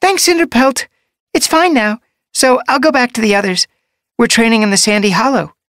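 very high, bouncy kitten voice; excited young apprentice. A narrator's reading. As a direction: chirp it eagerly, ready to run.

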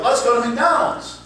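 high play-acted invitation; eager suggestion. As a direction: open the scene with put-on eagerness. Acted scene opener, repeated.

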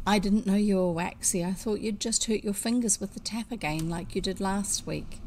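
ordinary delivery, nothing marked

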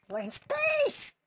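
very high, slow, loud squeal; silly falsetto cry. Pretend. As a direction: stretch SPACE in squeaky falsetto. Silly announcement in game.

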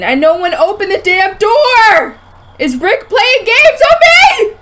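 loud protest climbing into a high yell; rising alarm. Real, not acted. Alarmed at door in game.

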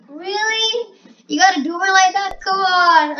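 loud, high, exasperated protest; frustrated shout at the screen; frustrated outburst. Real, not acted. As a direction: yell at the game in disbelief. Frustrated at the game's answer.

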